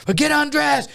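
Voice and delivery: high-pitched guard orders, imitated; barking guard. A guard orders the inmate against the wall, high and commanding. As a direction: bark out the strip-search orders like a guard. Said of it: "And all that".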